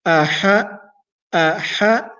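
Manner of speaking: throat sounds of Aha. Shown as a demonstration. Teacher drilling the throat letters of Aha. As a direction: Aha from the bottom to the middle of the throat. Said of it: "bottom of the throat, then move to the middle".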